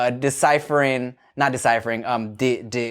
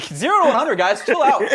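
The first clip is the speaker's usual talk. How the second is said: mock exasperated Brit; 11 semitones higher than usual. Mocking Brits overreacting.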